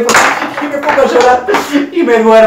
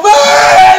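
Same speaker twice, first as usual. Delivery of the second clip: short high yelp; falling yell; 8 semitones higher, 6 dB louder than usual. Retold or imitated. Dropping off the rope, a high yelp. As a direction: yelp as you drop.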